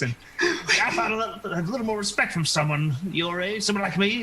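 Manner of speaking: put-on huffy elderly character demanding respect; indignant old woman. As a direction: scold them like an offended crone. Game master voices offended old woman.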